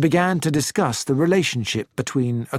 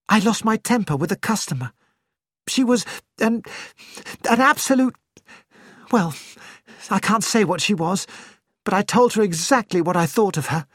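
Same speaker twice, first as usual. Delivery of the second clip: lightly voiced Jane, a bit quick and flustered; a hint of an upset young woman rushing her news; Jane, character voice; 7 semitones higher, faster than usual. A narrator's reading. Jane on the phone explains to Poirot, lightly flustered.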